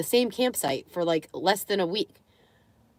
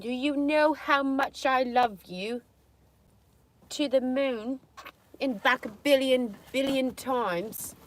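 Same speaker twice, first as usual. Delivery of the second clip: slow, higher, tender coo; doting coo; 5 semitones higher, slower than usual. Real, not acted. Owner cooing love to dog Bougie, slow.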